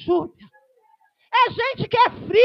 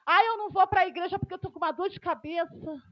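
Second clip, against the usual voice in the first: excuse-making member; 4 semitones lower, 7 dB quieter, faster than usual. Retold or imitated. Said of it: "Tem gente que fala assim".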